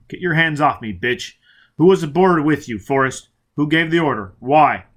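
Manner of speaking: loud, raging demands; savage shout. Acted. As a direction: snarl and roar at them. Foyle savage, shoving Robin off.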